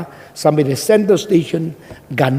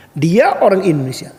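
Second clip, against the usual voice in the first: ordinary delivery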